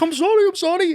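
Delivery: very high, panicky pleading in mock fear; scared apology. Fighter pinned against the cage begging his coach, mock-panicked and very high. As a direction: squeak it out like a cornered fighter. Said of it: "I shit myself".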